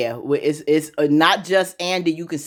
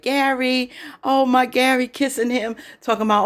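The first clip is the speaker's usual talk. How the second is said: put-on gushing girlfriend; 7 semitones higher than usual. Retold girlfriend gushing over Gary.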